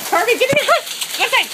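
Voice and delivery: high, loud, fast command; calling the dog in. Real, not acted. Ordering her dog in out of the hail, loud high call. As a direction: yell at the dog to get inside.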